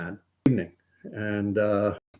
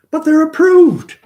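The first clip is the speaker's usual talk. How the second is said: very high, much louder mock insistence; imitating Anu; 12 dB louder than usual. Tennis partner insisting the shots are approved, very high loud mock voice. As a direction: blurt it out like a trump card. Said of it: "And she said".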